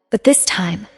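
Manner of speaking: ordinary delivery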